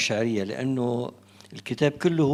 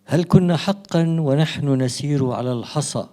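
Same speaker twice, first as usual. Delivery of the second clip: louder, measured declamation in formal Arabic; poetry recitation; 7 dB louder than usual. Acted. Poet reads from the book to a soirée audience, declaimed verse. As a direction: recite it out line by line, with spaced pauses. Recites own poem aloud.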